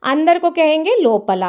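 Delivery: normal talking voice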